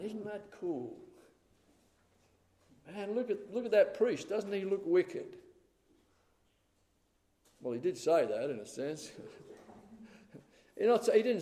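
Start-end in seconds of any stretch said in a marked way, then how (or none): 0.0-5.4 a hushed, higher mock of teen slang; lightly voiced trendy kid, half-hearted; slangy youth. Preacher imagining Jesus using slang, lightly mocking. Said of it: "temple there and saying".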